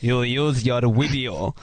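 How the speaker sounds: VIDEO said slowly as "wideo"; mock mispronunciation. Co-host teasing reader over a slip, mispronouncing VIDEO. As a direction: tease the slip, V of VIDEO turned into W. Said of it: "Do it in a Georgia accent".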